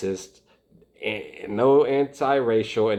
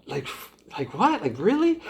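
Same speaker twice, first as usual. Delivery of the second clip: much higher, stunned questions; blown away; 11 semitones higher than usual. Real, not acted. Reacting to Dom's racism, stunned and disbelieving. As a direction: sound truly blown away. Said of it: "I'm blown away".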